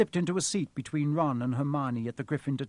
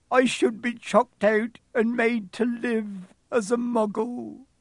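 high, loud, slow wailing confession; sobbing; 9 semitones higher, 7 dB louder, slower than usual. A narrator's reading. Hagrid confesses he told about Fluffy, sobbing with remorse. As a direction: wail with guilt and remorse, fully acted. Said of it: "he sobbed".

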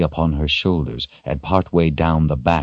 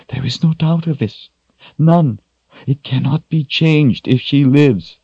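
urgent plea in a raised pitch; nearly in tears; 8 semitones higher than usual. A narrator's reading. Shota urges Richard to act, nearly in tears. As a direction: press the point, close to tears. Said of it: "nearly in tears herself".

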